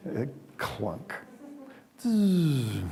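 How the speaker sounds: soft imitated clunk then buzzing zzz; mock clunk and buzz. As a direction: make the switch and hum noises. Imitates power switch sounds.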